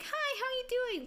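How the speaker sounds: mocking her own fake work voice; fake customer service voice. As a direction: put on a bright false-cheer hello. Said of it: "'Cause I know I'm fake".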